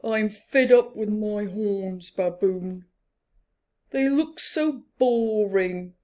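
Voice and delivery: loud fed-up complaint; complaining Rhino. Acted. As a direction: moan about your boring horns. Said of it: "complained Rhino".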